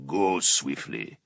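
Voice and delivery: low, slow and sorrowful; mournful rich voice. Acted. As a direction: grieve as you say it. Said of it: "rich with the mournful timbre of Vulcan's sons".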